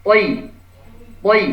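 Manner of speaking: slow, heavy "poi" with the tongue lifted to the palate; heavy thi. Shown as a demonstration. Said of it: "ini terangkat".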